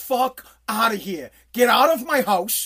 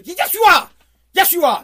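loud and higher, brooking no argument; mock insistent shout; 6 semitones higher, 8 dB louder than usual. Big brother shouting down the excuse, loud. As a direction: bark the answer down like a big brother.

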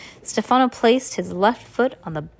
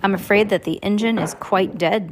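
ordinary delivery

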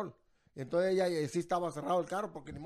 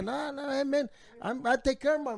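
higher-pitched, insistent re-enactment; retold English protest; 6 semitones higher, slower than usual. Man retelling defence to animal control officer, insistent protest. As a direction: plead your case in English, acting it out. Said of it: "al señor en inglés".

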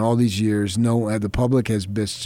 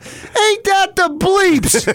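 high, loud and slow; loud drawn-out retort; 5 dB louder, much slower than usual. Retold or imitated. Father bellowing a profane reply across the gift shop, loud slow.

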